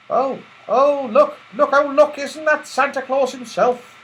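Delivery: high, loud excited cry. Acted. Beth spotting Santa Claus, crying out excitedly. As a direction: point and gasp with excitement. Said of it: "cried Beth".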